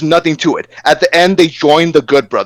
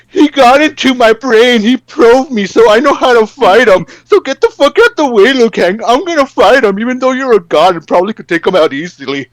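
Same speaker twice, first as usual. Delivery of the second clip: mocking Sub-Zero voice; 7 semitones higher, 3 dB louder than usual. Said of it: "he's like".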